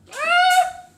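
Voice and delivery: sharp high yelp of pain; pained shriek. Real, not acted. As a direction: yelp as the spice hits. Reacting to burning spicy noodles.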